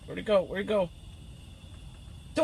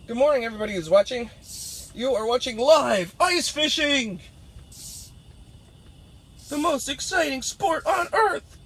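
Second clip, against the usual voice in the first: hyped-up TV announcer, higher pitch; hammed-up broadcast intro; mock sports announcer; 4 semitones higher than usual. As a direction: sell ice fishing like the biggest sport on earth. Hosts mock live sports broadcast.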